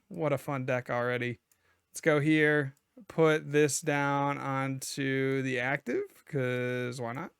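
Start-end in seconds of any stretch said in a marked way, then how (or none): none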